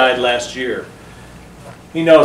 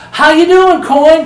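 very high, loud greeting; cousin's mother; 13 semitones higher, 7 dB louder, slower than usual. Retold or imitated. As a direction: hail your son in a high, big voice. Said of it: "Just the way she talked".

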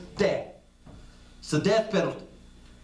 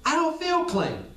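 doubting believer; 7 semitones higher than usual. Pretend.

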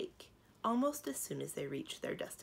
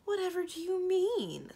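much higher, loud astonished question; 13 semitones higher, 7 dB louder than usual. Acted. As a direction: gasp it out in astonishment. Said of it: "said in astonishment".

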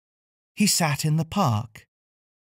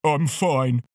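sniffed. Acted. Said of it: "sniffed Elvis".

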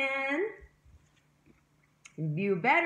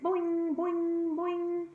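higher bouncy boing sounds; playful boing; 6 semitones higher, much slower than usual. Acted. Teacher making kangaroo hop sounds for her class.

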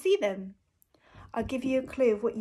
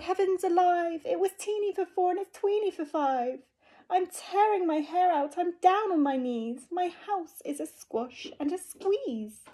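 put-on distressed old lady; 7 semitones higher than usual. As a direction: wail about the cramped house. Lady cries out.

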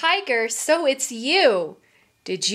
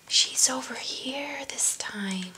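soft conspiratorial whisper; 9 dB quieter than usual. Real, not acted. Aside to kids.

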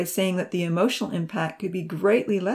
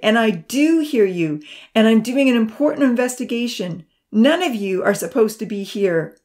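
loud call; 4 semitones higher, 7 dB louder than usual. A narrator's reading. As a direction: call out to send the children off. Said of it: "Amy called out loudly".

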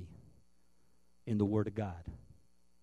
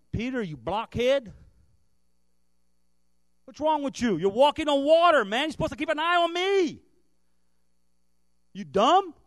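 much higher and louder, exasperated yelling; hammed-up berating, the way Jesus did not speak; mock screaming; 13 semitones higher, 11 dB louder than usual. An imagined scolding Jesus yelling at Peter, mock furious. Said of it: "There was no screaming at him".

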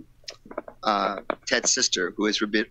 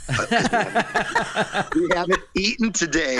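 laughing; 8 semitones higher than usual. Retold or imitated. Laughing while quoting friend.

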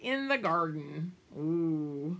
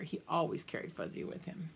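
soft aside; 4 semitones lower, 8 dB quieter, faster than usual. A narrator's reading.

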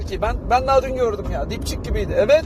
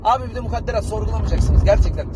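ordinary talk

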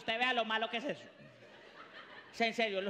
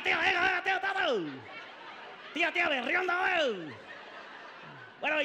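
rowdy country cousin; 4 semitones higher than usual. Acted. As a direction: whoop like a drunk paisa cousin. Country cousin shouting at party.

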